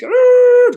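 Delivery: a sudden high, loud screech of brakes; mock tire screech. Imitating cars slamming brakes on the street, loud high screech. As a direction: blast out a squealing-brakes noise.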